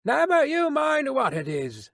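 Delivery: high, drawn-out guard's retort; sharp retort. A narrator's reading. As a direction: rebuff the stranger in a high calling voice. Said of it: "the guard retorted".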